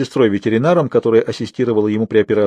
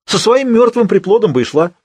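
high, loud, cruel jibe; scornful outburst; 11 semitones higher, 5 dB louder than usual. Acted. Hairdresser turning on the grieving girl, loud and scornful. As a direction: lash out with raised pitch and volume.

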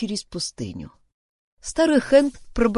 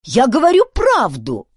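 raised, emphatic protest; high, forceful assertion; insistent; 7 semitones higher, 8 dB louder than usual. A narrator's reading. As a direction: insist loudly on being believed. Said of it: "настаивал Филберт".

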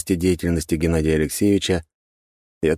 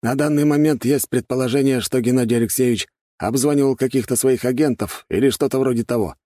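higher-pitched gendarme chief, lightly voiced; 9 semitones higher, 4 dB louder than usual. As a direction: lightly play an official reporting information, pitched up. Глава жандармов добавляет версию. (слегка сыгранный начальник жандармов, голос повыше)

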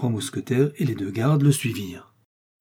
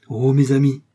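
loud, emotional outburst; raised voice, full of feeling; heartfelt exclamation; 3 semitones higher, 7 dB louder than usual. A narrator's reading. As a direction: exclaim it to the friends who saved you. D'Artagnan overcome, exclaims to his friends.

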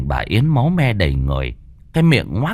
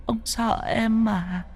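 high, soft, slow ghostly coaxing; a dead wife luring her husband in a thin high voice; eerie ghost wife; 9 dB quieter, slower than usual. A narrator's reading. Ghost wife coaxing her frightened husband, high and soft.

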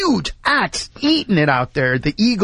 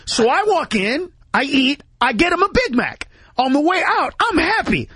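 usual speaking voice

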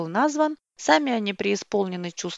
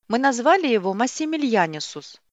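proud, a bit louder and higher; proud announcement; 5 semitones higher, 4 dB louder than usual. A narrator's reading. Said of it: "с гордостью произнёс громкое имя".

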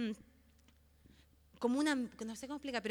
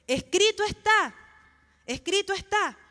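proclamar con firmeza, más fuerte y agudo; declaración tajante; 6 semitones higher, 7 dB louder than usual. Acted. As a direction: declare it loud and high, like a rebuke. Quotes Jesus rebuking Satan, emphatically. (proclamación firme, en voz alta)

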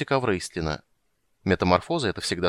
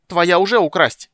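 high, loud, drawn-out accusation; squealing, slow and forceful; shrill squeal; 9 semitones higher, 9 dB louder than usual. A narrator's reading. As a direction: screech it like an outraged little creature. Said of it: "взвизгнула Бупу".

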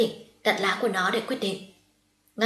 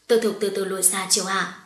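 ordinary delivery

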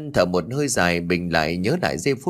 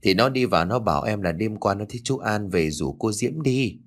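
ordinary delivery, nothing marked

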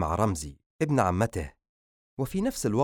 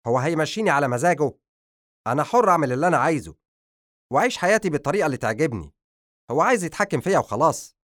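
loud, fast and higher furious rant; angry; 6 semitones higher, 6 dB louder, faster than usual. Acted. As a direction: rage against control. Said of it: "قال بعصبية".

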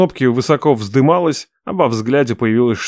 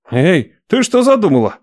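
frightened gasp; 7 semitones higher than usual. Acted. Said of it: "испуганно ахнул Мот".

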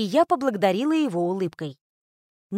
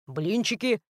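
Puzacho's voice; 4 dB quieter than usual. A narrator's reading. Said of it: "Пузачо выглянул из кухни".

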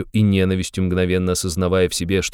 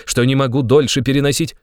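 higher, tense protest; angry, raised voice; furious cry; 6 semitones higher, 4 dB louder than usual. A narrator's reading. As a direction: burst out in restrained fury. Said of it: "вскричал в ярости Раскольников".

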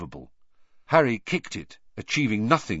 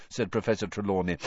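ordinary delivery, nothing marked

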